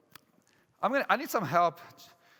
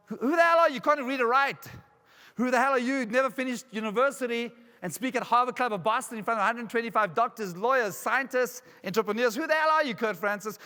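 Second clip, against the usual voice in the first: high, forceful acted accusation; indignant challenge; 4 semitones higher than usual. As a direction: hammer each challenge, pitch jumping up. Plays accuser doubting his achievements.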